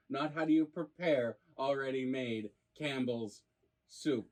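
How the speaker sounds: normal talking voice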